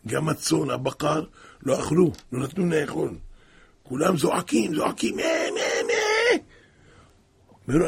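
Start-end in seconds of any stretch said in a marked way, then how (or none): 5.2-6.4 put-on children's wail rising to a shout; wailing cry. The children crying out.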